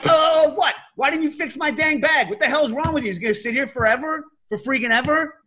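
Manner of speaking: mock New York rant. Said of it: "I'm like".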